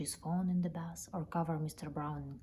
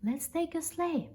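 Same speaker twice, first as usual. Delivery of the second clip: much higher, eager cry; excited cry; 10 semitones higher than usual. Acted. Bear calling eagerly to the man, much higher and excited. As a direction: sing it out with glee. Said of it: "Paddington cried".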